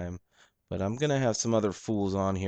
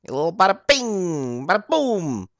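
loud, high Brooklyn bada bing; Brooklyn wise guy; 10 semitones higher, 9 dB louder than usual. Podcaster hams up a Brooklyn catchphrase, loud and high. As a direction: belt out "bada bing, bada boom" like a Brooklyn mobster. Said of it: "that little bada boom, bada bing part".

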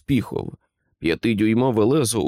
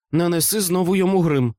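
high, mildly hissed order; higher-pitched urgent aside to an accomplice; 8 semitones higher, faster than usual. A narrator's reading. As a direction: hiss the instruction, lightly voiced. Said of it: "просичала Норі".

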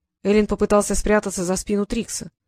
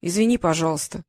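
ordinary delivery, nothing marked

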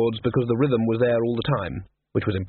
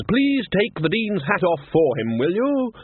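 Ridcully voiced; 7 semitones higher, slower than usual. A narrator's reading. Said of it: "said Ridcully".

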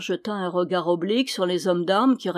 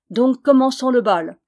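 loud, higher-pitched command; rally the men into action; commanding order; 5 semitones higher, 8 dB louder than usual. A narrator's reading. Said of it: "ordonna Méloir".

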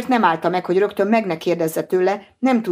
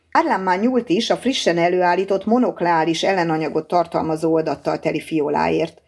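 ordinary delivery

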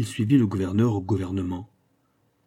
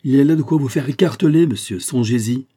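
higher and much louder, a stern threat; threatening; 4 semitones higher, 7 dB louder than usual. A narrator's reading. Minister threatening the governor, a loud stern warning. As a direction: make him feel the danger.

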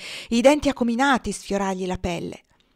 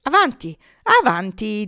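goading challenge; 7 dB louder than usual. A narrator's reading. Cow dares the boy forward, goading call. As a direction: egg him on.

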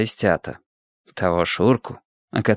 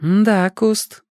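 meaningful; 10 semitones higher than usual. A narrator's reading. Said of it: "многозначительно сказал Женька".

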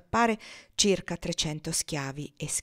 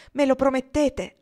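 higher, louder urgent plea; pleading; 8 semitones higher, 10 dB louder than usual. Acted. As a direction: beg him to promise. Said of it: "repeated plea, louder".

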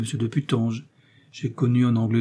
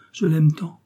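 higher, heartfelt outburst; exclaiming; 5 semitones higher, 3 dB louder, slower than usual. A narrator's reading. Madame Bonacieux confessing on Milady's shoulder, exclaiming. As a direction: pour out the love, mildly impassioned. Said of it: "s'écria-t-elle en se laissant aller".

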